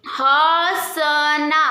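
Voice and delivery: हसना stretched very slowly, nasal अ held; drawn-out demo word. Shown as a demonstration. Teacher to pupils, modelling the nasal vowel, slow हसना. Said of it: "ह के बाद देखो अ का pronounce".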